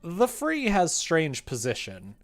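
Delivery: loud, exaggerated questioning read; theatrical comment reading. Streamer reading a viewer's puzzled critique, loud and theatrical. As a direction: read it out big, pitch swooping up and down.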